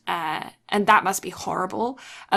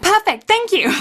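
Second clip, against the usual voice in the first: high, loud, sweetly satisfied; triumphant chirp; 13 semitones higher, 8 dB louder than usual. Retold or imitated. Girl thanking the boy after getting her way, high and loud. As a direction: snap it up like a win.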